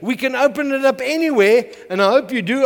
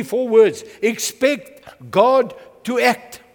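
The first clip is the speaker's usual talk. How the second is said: ordinary delivery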